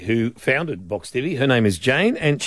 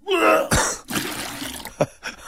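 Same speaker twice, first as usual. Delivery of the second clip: high breathless retching noise; hammed-up gagging sound; fake retching heave. Shown as a demonstration. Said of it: "and you do that".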